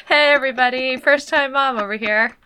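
loud, high mock call to the crowd; embarrassed mom loudly addressing everyone; mock announcement. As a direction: announce it to the whole parking lot, hammed-up. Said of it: "And I was like".

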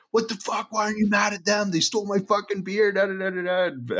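high-pitched imitation of a furious rant; mock angry yell. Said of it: "like, yelling at Joe's mom".

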